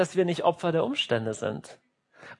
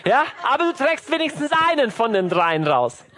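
would-be nice parent; 4 semitones higher, 3 dB louder than usual. Acted. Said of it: "du willst so nett sein".

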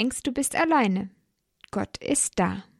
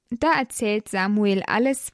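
plain reading voice

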